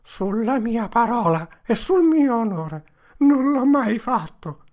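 high, loud tearful oath; desperate plea. Acted. Potter swearing innocence to the crowd, loud and tearful.

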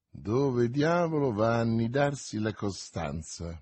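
usual speaking voice

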